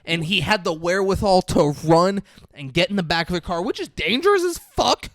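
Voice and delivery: can't believe what he saw, climbing in pitch; excited retelling. Real, not acted. Recapping the burning men for his co-host, excited and loud.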